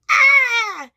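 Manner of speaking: sudden high, loud scream. Acted. Sparky screaming as a burnt-up moth. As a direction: shriek as the moth hits the bulb. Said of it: "He screamed".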